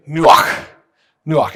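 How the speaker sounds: nuach with a breathy back-of-the-throat ch; guttural nuach. Shown as a demonstration. Pastor leading the congregation to say nuach, guttural. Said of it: "So say it with me".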